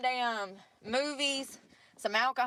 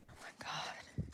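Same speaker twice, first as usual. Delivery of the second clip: unvoiced, hushed whisper; awed whisper; 13 dB quieter than usual. Real, not acted. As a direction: breathe it out in quiet awe. Alone staring at the snow.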